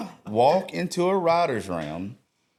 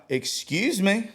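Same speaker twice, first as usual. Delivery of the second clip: retold outburst; 9 semitones higher than usual. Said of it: "And I was like".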